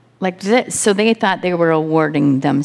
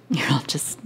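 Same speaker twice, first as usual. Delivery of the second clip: laughing whisper; 3 semitones lower, 6 dB quieter than usual. Real, not acted. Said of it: "I'm not getting much out of you guys".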